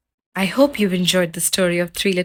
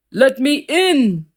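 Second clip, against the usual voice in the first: loud, slow, higher wolf roar; 7 semitones higher, 5 dB louder, slower than usual. Acted. Angry wolf roaring at Pete's door, loud and slow. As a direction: roar it through the peephole. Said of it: "roared the wolf".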